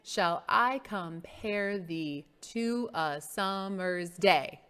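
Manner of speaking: slow, syllable by syllable; slow measured recitation. Shown as a demonstration. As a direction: recite it slowly so each syllable can be counted. Reciting line to count syllables.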